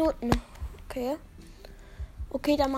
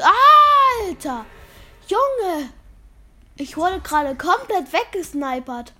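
yelling in shock; loud, high outburst; excited shout; 6 semitones higher, 12 dB louder, slower than usual. Real, not acted. Gets sniped in game.

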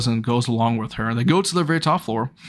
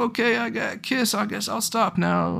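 put-on higher and softer, like a dazed teenager; dazed after a kiss; 8 semitones higher, 4 dB quieter than usual. Boy stunned after sudden kiss.

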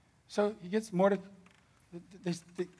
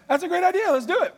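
higher, fast sarcastic agreement; mock eager king; 10 semitones higher than usual. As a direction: rattle it off like a king who never thinks. Mocking king again, fast.

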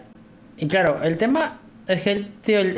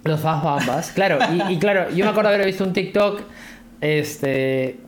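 ordinary talk, nothing marked

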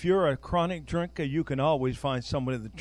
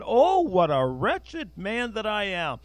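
slow, raised lament quoting Paul; Paul crying out his wretchedness, slow and pitched up; mild lament; 6 semitones higher, 3 dB louder, slower than usual. Retold or imitated. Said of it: "He said".

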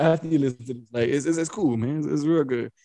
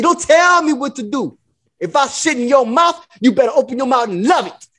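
high, mock-outraged pimp bluster; put-on pimp talk, pitched up; Iceberg Slim; 6 semitones higher than usual. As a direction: retort like a cocky pimp. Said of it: "I was Iceberg Slim in that shit".

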